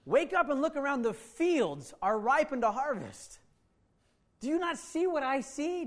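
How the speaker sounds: Jesus urging disciples to see the harvest; urgent Jesus. Acted. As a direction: call them to look. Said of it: "right after they missed it again".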